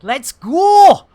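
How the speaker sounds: loud, badly faked Scottish accent. Streamer geeing himself up to go, mock Scottish accent. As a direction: put on a hammy, poor Scottish accent. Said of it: "that's the worst Scottish accent I've done".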